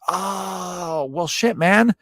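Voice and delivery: long high drawn-out "oh" of realization; suddenly won over. Acted. Woman learning her hand-kisser is a knight, suddenly impressed.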